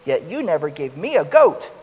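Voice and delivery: loud, bitter complaint to his father; bitter angry protest. Acted. Older son to his father, loud bitter protest. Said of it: "he responded bitterly and angrily".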